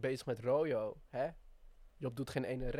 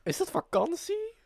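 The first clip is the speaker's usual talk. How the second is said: mock surprise; 14 semitones higher, 6 dB louder than usual. Mocking echo of Job.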